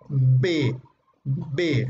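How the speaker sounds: B, pressed lips; B, lips together; demonstrating B sound. Shown as a demonstration. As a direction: say B with both lips. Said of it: "Por ejemplo, la B".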